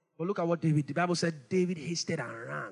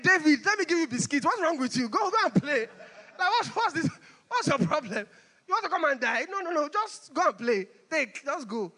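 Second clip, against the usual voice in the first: mocking big brother; 6 semitones higher than usual. Preacher voicing brothers brushing off young David, mocking.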